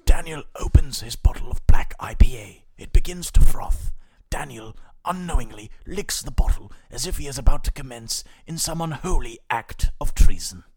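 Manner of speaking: lightly done nature documentary narrator; hushed, loud mock nature commentary; David Attenborough. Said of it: "a quick David Attenborough rundown".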